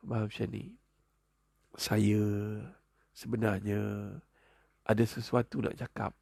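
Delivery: tentative, acted request to husband; hesitant wife. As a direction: broach it gingerly with long pauses. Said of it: "minta izin dulu".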